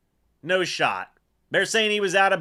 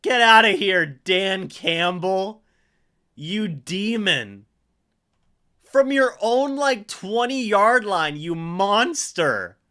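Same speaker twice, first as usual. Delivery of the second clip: mock outrage; 3 semitones higher, 4 dB louder, slower than usual. Real, not acted. Mock scolding the Lions coach.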